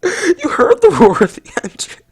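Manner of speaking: very high, loud mock-tearful cry; overcome, wailing. Reviewer overcome by the game's engine roar, wailing. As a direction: let the voice leap into a sob.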